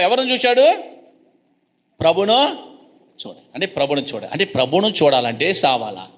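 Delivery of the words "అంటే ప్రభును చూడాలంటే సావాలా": usual speaking voice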